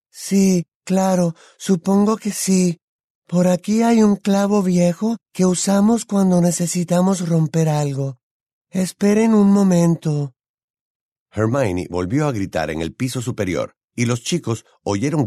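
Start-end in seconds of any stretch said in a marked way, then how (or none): 0.1-10.3 put-on high, dreamy girl's voice; pitched-up, louder, unhurried tone; Luna. A narrator's reading. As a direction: answer in a raised, airy character voice.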